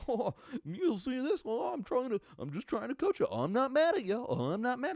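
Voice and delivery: high, sing-song mock chipper coach voice. Mocking the sideline coaches, high and chipper. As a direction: play the grinning coach who insists he's not mad. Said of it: "They were like".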